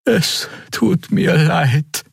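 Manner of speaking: tiny high voice, slow and halting; trembling Draxil. A narrator's reading. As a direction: apologize in a high, trembling creature voice. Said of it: "sagte die zitternde Draxil". (hohe, zitternde, stockende Stimme)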